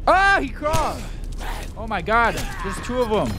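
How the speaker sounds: high, loud surprised cries; startled yelp. Real, not acted. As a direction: jump at the sudden enemy. Enemy surprises him.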